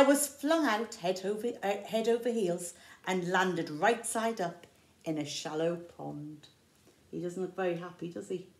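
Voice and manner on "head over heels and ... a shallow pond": ordinary delivery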